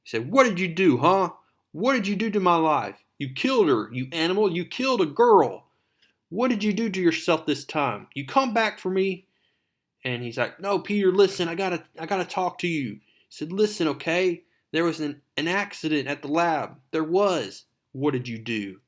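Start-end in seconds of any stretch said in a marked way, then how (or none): 0.3-9.3 loud, high, heated confrontation; angry accusation. Retold or imitated. Peter accusing Doctor Connors of killing Gwen, angry. 10.6-12.9 high, insistent acted plea; urgent pleading. Connors trying to calm accusing Peter, urgent pleading. 13.5-17.6 high-pitched, insistent explaining; acted-out plea to be believed; urgent pleading. Connors explaining the lab accident to Peter, urgent pleading.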